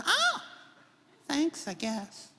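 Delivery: high squeaky oh, then slow unsure thanks; puzzled intern. Retold or imitated. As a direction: sound baffled and polite.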